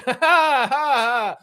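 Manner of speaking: high unhinged cackle in the neighbor voice; manic laugh. Retold or imitated. Proud neighbor laughs at the destroyed house, high manic cackle. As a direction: cackle like a gleeful madman.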